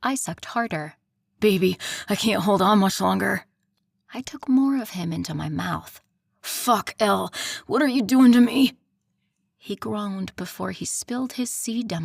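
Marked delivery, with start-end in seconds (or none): 1.4-3.4 urgent strained male voice. Acted. Lover near climax, straining. 6.4-8.8 louder strained outcry; loud cry. Acted. Lover crying out at climax.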